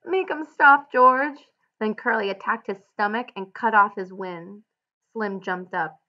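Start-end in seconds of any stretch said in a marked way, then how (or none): 0.0-1.4 loud, high plea for help; terrified cry. A narrator's reading. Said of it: "He cried".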